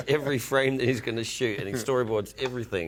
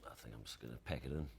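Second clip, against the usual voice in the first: low, hushed mock-weary aside; softly retold resignation; quiet resignation; 13 semitones lower, 17 dB quieter, faster than usual. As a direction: say it quick and very softly, as if giving up. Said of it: "Eight times I've said".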